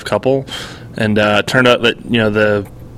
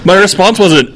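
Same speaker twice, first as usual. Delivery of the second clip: usual speaking voice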